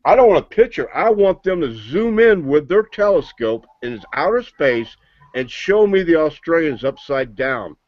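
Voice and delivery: ordinary delivery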